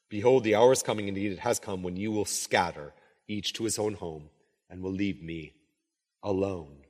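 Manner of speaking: low, measured Bible reading; solemn reading that grows hushed; scripture reading. Acted. Reads scripture aloud.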